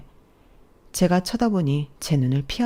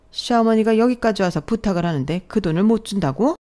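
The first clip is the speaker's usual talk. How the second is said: raised, indignant haranguing; outraged mother-in-law; 4 semitones higher, 4 dB louder than usual. Acted. Mother-in-law scolds daughter-in-law for refusing money, outraged.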